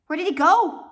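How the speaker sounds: high, loud alarmed shout. A narrator's reading. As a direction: call out in alarm across the theater. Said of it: "Poe shouted".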